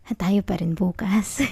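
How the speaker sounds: slow hushed whisper; a soft whispered promise. Acted. Reassuring partner at goodnight. (mabagal na pabulong)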